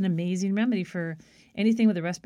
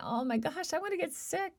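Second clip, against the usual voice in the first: sick worry; 10 semitones higher, 5 dB quieter than usual. Retold or imitated. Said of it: "I thought".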